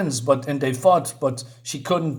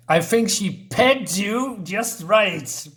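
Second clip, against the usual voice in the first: slowly voiced side character; lightly put-on voice teasing Kurt; 5 semitones higher, much slower than usual. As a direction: drawl the line slowly in a put-on voice. Voiced character line, far.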